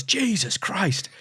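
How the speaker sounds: high, drawn-out imitated cry; mock pained exclamation. Reverend just shot with a shotgun, mock exclamation. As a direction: stretch it out as a hammed-up cry.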